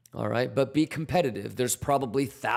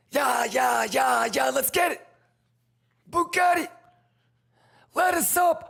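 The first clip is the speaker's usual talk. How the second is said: high, loud victory yelling; hype shouting; 13 semitones higher, 4 dB louder than usual. Real, not acted. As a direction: celebrate the win loudly. Trade winning hype.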